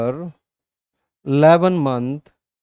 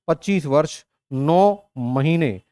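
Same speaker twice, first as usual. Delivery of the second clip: very slow, deliberate; slower than usual. Shown as a demonstration. Teacher spells out age slowly.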